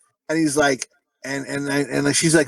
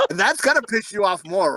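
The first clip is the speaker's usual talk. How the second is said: usual speaking voice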